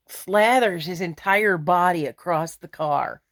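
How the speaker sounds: ordinary delivery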